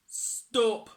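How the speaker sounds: loud pirate command; called out. A narrator's reading. Pirate chief halts plank walk, calling loudly. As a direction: call a sharp halt. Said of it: "called Hank Chief".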